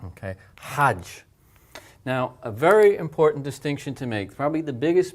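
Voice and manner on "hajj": "hajj" with a breathy Arabic hard h. Shown as a demonstration. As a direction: tighten the throat for the h of "hajj". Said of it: "you hear the air coming out".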